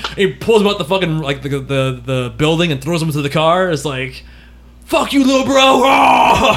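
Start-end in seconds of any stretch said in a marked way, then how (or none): 4.9-6.6 high shouted taunt. Retold or imitated. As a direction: yell it like a bully brother. Predator taunt voiced.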